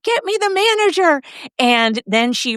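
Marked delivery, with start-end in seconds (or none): 0.0-1.2 high-pitched mock urgent order; urgent demand. Sister-in-law to frightened bank tellers, mock urgent demand.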